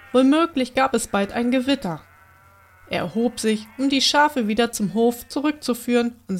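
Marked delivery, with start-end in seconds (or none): none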